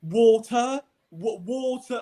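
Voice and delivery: ucapkan "Water" pelan dan keras, seperti bicara pada orang asing; slow loud English. Retold or imitated. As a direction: drag out "Water" loudly for a foreigner. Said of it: "slowed down as if they're gonna understand".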